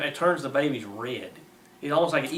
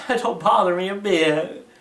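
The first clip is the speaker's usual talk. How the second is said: slow, laughing mockery of a callous doctor; mocking quote; 4 semitones higher, 6 dB louder than usual. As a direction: sneer it out with a laugh, drawn out. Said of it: "How can you perform that and say".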